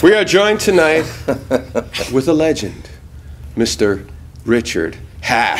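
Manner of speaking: building to a grand low introduction; dramatic announcer. Acted. Host introducing the guest, dramatic announcer build. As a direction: present the legend with gravitas. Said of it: "give that a slightly different line rating".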